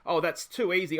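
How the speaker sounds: ordinary talk, nothing marked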